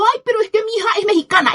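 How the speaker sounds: high, fast, mocking whine; a sneering impression of her justification; mocking whiny quote. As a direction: rattle off her excuse like a sneer. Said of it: "Y hablando".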